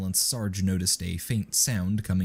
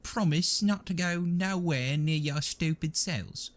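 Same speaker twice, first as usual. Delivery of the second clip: high, nervous trooper voice; panicked whine; 10 semitones higher than usual. A narrator's reading. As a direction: make excuses in a panic. Nubby's panicked excuse continues.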